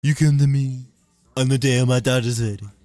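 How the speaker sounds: put-on loud, high Italian mobster Trump accent; the Godfather in Trump's Italian voice. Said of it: "Italian Trump".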